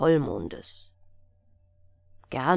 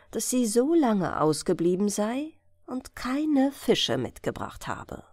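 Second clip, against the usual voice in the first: ordinary delivery, nothing marked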